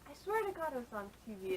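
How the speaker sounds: ordinary delivery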